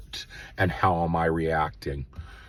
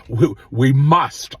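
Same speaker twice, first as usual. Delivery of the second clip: emphatic urging; 6 semitones higher, 8 dB louder, slower than usual. Real, not acted. Urging listeners to respond with love.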